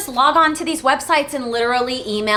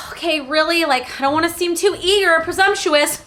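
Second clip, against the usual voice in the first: higher, worried objection; hesitant reluctance; 6 semitones higher than usual. Retold or imitated. Daughter unsure about following up, high hesitant voice. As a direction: re-enact a reluctant daughter, pitched up. Reluctant reply to dad acted.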